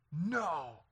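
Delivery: put-on child's drawn-out defiant voice; imitating defiant child. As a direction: mimic a little girl refusing. Daughter refuses again.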